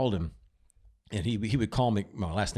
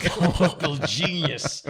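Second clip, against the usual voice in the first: slow, louder words through a laugh; 6 semitones higher, 5 dB louder, much slower than usual. Real, not acted. Wrapping up the movie story to co-hosts, laughing.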